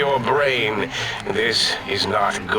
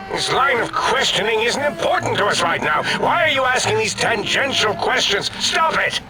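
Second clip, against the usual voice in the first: annoyed; 7 semitones higher, faster than usual. Acted. Brain snaps at questions.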